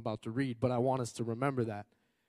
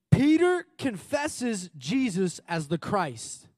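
slow header read; 6 semitones higher, 6 dB louder, much slower than usual. Real, not acted. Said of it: "it says".

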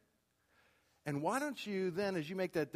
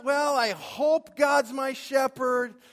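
unsure doubter voice; 7 semitones higher, 7 dB louder than usual. Retold or imitated. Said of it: "It's not".

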